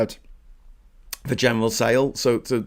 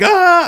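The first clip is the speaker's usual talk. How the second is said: short sharp high scream, much louder; mock scream; 11 dB louder than usual. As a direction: yelp like someone caught by surprise. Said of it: "And they're like".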